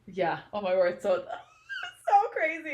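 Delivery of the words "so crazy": high, slow, laughing words; helpless laughter. Real, not acted. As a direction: crack up while saying it. Laughing at the book.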